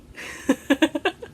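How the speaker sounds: high, breathy little laugh; short giggle. Real, not acted. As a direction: laugh at the mix-up. Amused by Harry Potter mix-up.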